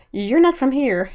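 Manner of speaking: haughty, looking down at her; snobby voice. Retold or imitated. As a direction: sniff it out like a snob. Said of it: "says in a really snobby voice".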